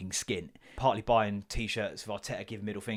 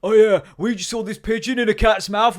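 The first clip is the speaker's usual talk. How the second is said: put-on loud, much higher kid voice; excited kids; 12 semitones higher, 13 dB louder than usual. Sons telling dad the news, loud high kid voice. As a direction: blurt it out like a boy. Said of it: "sons arrive".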